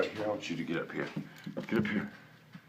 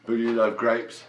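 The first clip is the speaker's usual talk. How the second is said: ordinary delivery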